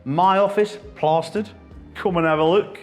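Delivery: normal talking voice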